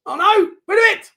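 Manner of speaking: high, loud panicked shout; panicked yell. Real, not acted. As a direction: scream at them to clear it. Panic in own box.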